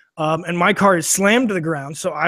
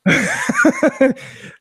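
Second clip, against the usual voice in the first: laughing. Real, not acted. Laughing at own story.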